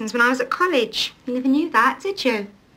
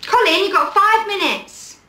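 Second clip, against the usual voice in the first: raised, slow call across the room; a boss summoning the worker back, drawn out and raised; 7 semitones higher, 4 dB louder, slower than usual. Acted. Boss calls Colin back from his tea break, raised and slow.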